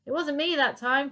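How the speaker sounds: calling out. Acted. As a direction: call out through the door. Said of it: "called back Dotty".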